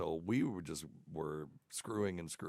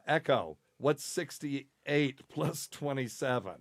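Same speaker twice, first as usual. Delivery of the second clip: calling out to device; 6 semitones higher, 5 dB louder, slower than usual. Real, not acted. Commanding smart speaker.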